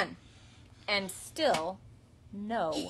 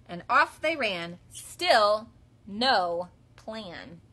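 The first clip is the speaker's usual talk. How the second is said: slow dry refrain; 7 dB louder, much slower than usual. A narrator's reading.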